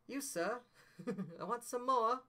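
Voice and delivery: put-on a mangled British accent, pitched well up, giggling through it; bad Oliver accent. Doing a horribly mangled British Oliver line for his partner, high pitched. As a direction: ham up a bad British Oliver Twist plea. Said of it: "if I do the British accent, like, and horribly mangle it".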